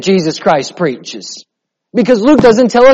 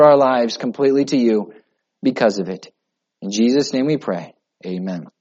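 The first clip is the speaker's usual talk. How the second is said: prayer; 5 semitones lower than usual. Acted. Closing prayer before the reading.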